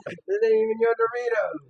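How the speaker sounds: very high, loud squawk; loud protest. Real, not acted. Squabbling with friend over Doritos, high loud protest. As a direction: shout it in squeaky indignation.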